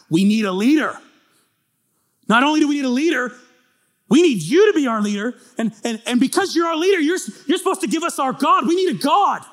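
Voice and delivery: loud, high, pushy demand; the people pressing Aaron hard; demanding crowd. Acted. The people loudly demanding that Aaron give them a god.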